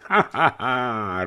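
a slow, drawn-out ha ha ha; slow hearty laugh. A narrator's reading. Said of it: "rozesmál se Porfirij". (pomalý srdečný smích)

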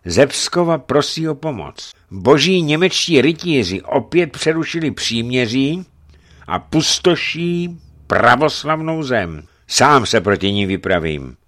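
high, loud news of war; rousing call for help; urgent bishop. Acted. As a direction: announce the danger with force.

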